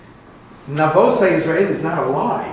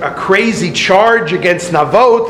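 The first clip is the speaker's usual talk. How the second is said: normal talking voice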